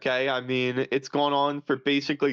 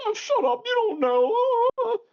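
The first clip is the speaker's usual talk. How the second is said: much higher, louder mock whine; mocking dismissive shriek; 3 dB louder, slower than usual. Mocking the crowd silencing skeptics, high and loud. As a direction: squeal it like a brushed-off scold. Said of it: "was just like".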